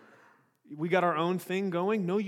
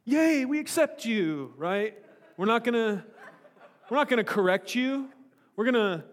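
mock welcome; 6 semitones higher, 6 dB louder than usual. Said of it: "the Corinthians are going".

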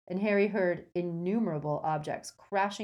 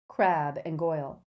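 usual speaking voice

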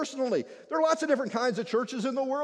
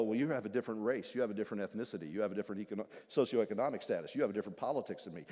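low, soft, dismissive list; judgy churchgoer; 14 semitones lower, 8 dB quieter, much faster than usual. Acted. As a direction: sort people with disdain. Judgmental churchgoer quoted.

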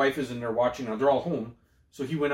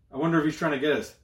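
ordinary delivery

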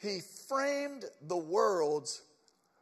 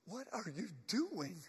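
soft, stunned question; hushed disbelief; 11 dB quieter than usual. Acted. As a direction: ask it under your breath, as if seeing the dead. Acts stunned friends of risen Lazarus.

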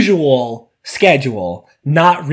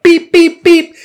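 reversing beep; 12 semitones higher, 6 dB louder, slower than usual. Retold or imitated. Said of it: "visual of this woman".

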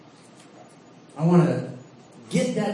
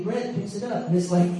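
ordinary talk

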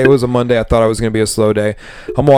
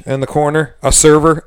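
normal talking voice

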